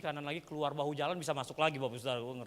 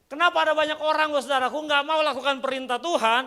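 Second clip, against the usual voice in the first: loud, high preacher's cry; impassioned high-pitched sermon shout; full-force preaching; 11 semitones higher, 13 dB louder than usual. Real, not acted.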